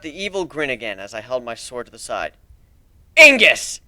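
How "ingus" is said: loud spell cry; shouted spell. Acted. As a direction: fire spell yelled high and loud. Casting fire spell.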